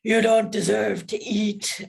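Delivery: slow, hammed-up villain voice playing the monster in her head; silly monster voice. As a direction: drawl it out like a cartoon bad guy taunting a kid. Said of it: "it was very silly".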